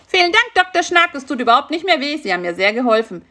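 high, fast tumbling thanks; relieved rush. Acted. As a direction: blurt it out while hurrying away.

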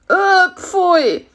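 high, loud cry of disgust. Acted. Fly is offered beetle eyes by spider, disgusted. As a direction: recoil in revulsion.

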